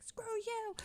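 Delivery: high, held-back mock yell. Trying out New York rudeness at other drivers, mock yell. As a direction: yell it without the volume. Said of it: "So I started yelling at the".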